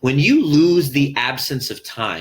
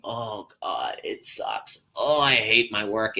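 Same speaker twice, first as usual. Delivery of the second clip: mock complaint; 3 semitones lower, 4 dB quieter than usual. Sarcastic mock complaint while working.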